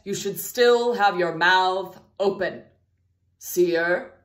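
normal talking voice

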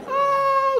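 very high, held-out oh; high nervous oh. Retold or imitated. Speaker reacts to minister shutting the door, high drawn-out oh. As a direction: stretch a tiny oh way up high.

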